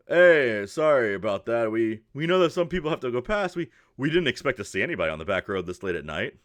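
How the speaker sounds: lightly voiced caravan man calling out; apologetic horseman. Gentleman on the horse greets the travelers, lightly voiced apology.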